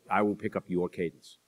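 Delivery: usual speaking voice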